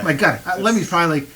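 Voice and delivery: ordinary delivery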